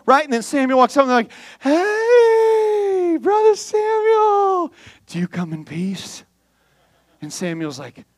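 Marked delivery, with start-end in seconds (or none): 1.6-6.2 very high, slow, sing-song greeting; butter up the prophet with a high sugary hello; nervous fake-sweet greeting. Preacher voicing the elders greeting Samuel, high and fake-sweet.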